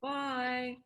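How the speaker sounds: wistful farewell; sad goodbye. Shown as a demonstration. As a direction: let the word sag as if sorry to part. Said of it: "if you're sad to say goodbye".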